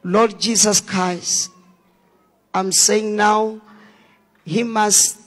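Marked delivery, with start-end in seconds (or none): none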